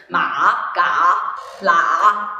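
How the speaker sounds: low, dipping Ma, ga, la; third tone. Shown as a demonstration. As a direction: dip each of "Ma, ga, la" deep, then lift it. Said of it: "the third tone sounds".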